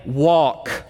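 low, settled order on walk; quiet command. Shown as a demonstration. Preacher showing Peter's order, low firm command. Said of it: "not a dream, command".

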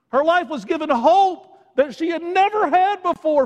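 high, forceful preacher's voice; fervent preaching. Real, not acted. Preacher urges congregation toward hope in Jesus, fervent. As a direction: swell with conviction, then press the questions.

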